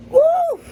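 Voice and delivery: high, loud whoop at the burn; real spicy whoop; heat whoop. Real, not acted. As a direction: let out a burning whoop.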